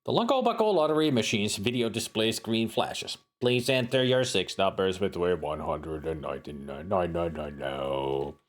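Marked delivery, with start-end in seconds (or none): 3.4-8.3 robot machine. Acted. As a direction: speak like a talking machine. Lottery machine display speaks.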